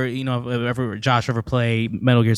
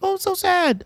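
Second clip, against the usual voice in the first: high, mock-weepy gushing; mock gushing fan. Mocking fans who called Coco sad, squeaky high. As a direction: parody people who cried at Coco. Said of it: "it was like".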